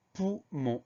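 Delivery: "poumon" slowly split into two syllables; teacher spacing out "poumon" for spelling. Shown as a demonstration. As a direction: dictate "poumon" with a gap between pou and mon. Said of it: "On a deux syllabes".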